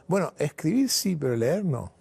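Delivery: sly punchline. Retold or imitated. Grandfather's punchline to clerk.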